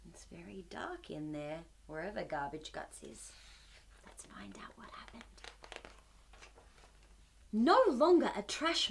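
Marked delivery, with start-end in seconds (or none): 0.1-3.3 soft, low hush for a dark scene. Acted. Reader hushes at dark scene. 4.0-5.2 put-on breathy whisper to the kids. Whispered aside to children.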